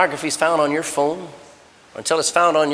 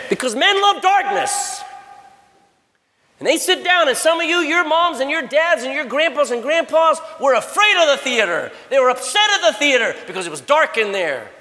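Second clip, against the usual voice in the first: full-force preaching; 7 semitones higher than usual. Acted. Preacher thunders at the youth about darkness. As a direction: preach it out to the crowd.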